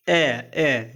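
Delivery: ए said twice as a clear "ay"; modelling the sound. Shown as a demonstration. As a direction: model ए for the class. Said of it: "ए का अर्थ होता है आय". (ए को धीरे से उच्चारण करके दिखाना)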